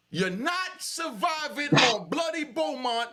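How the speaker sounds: loud, high, drawn-out chant of "You're not surviving"; slow, high, half-sung shout; shouted sing-song taunt. Real, not acted. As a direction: belt it out like a street taunt. Heated rant about a rival.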